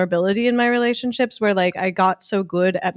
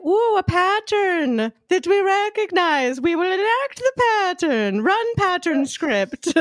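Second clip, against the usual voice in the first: high, slow mechanical robot voice; robot brain voice; 9 semitones higher, slower than usual. Pretend. Pam voicing her brain as a machine, slow high robotic tone. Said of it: "my brain is just like".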